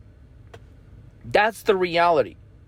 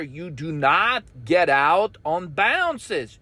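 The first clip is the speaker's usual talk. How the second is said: ordinary talk, nothing marked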